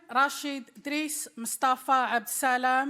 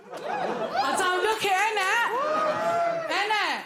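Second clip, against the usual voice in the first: pitched up, slow and giggly; laughing; 10 semitones higher, slower than usual. Acted. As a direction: squeal it out through laughter. Joking about age, laughing.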